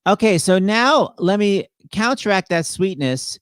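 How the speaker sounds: ordinary talk, nothing marked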